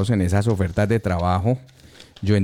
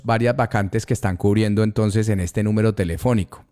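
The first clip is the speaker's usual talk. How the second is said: ordinary delivery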